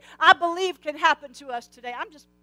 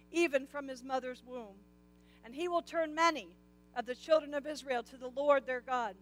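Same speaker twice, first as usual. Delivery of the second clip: measured, softer Bible reading; scripture reading; 3 semitones lower, 7 dB quieter than usual. Acted. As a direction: read the verses out to the congregation. Reads Luke scripture aloud.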